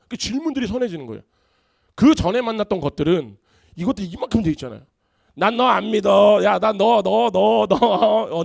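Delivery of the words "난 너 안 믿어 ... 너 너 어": loud, high, drawn-out taunt; acted jeer, stretched out and raised; mock challenge. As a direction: loudly play a friend scoffing in disbelief. Mocking friend challenges him in a story.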